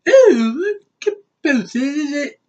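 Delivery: very high, slow, fluting British put-on; mock proper British. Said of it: "very, too proper".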